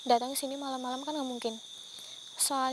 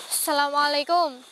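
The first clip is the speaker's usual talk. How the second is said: slow, loud greeting called into the dark; 7 dB louder than usual. Real, not acted. Calling out to the hidden spirit, slow and loud. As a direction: call out the greeting slowly.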